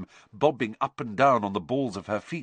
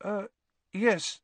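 nervously; 12 semitones higher than usual. A narrator's reading. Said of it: "said Neville nervously".

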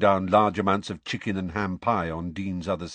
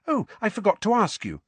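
bright and much higher, lively; brightly; 12 semitones higher than usual. A narrator's reading. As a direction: toss it off cheerfully. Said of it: "said Hermione brightly".

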